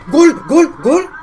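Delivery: full-voiced high goal yell, repeated; goal shout. Real, not acted. Fan yelling at the screen as the ball goes in, a real high shout. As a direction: belt out each gol as it hits the net.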